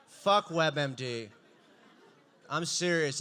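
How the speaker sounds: ordinary delivery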